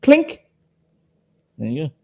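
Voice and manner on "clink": a high, bright mimicked clink of glasses; ping it like two glasses meeting. Mimicking glasses touching after a toast.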